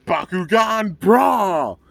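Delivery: loud, high, drawn-out anime battle shout; acted battle cry. Fan re-enacts the battle call before anyone speaks, battle cry. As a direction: call it out big, holding the last word.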